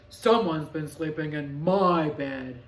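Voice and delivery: low, loud, slow big bear; Papa Bear voice. A narrator's reading.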